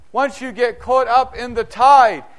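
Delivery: normal talking voice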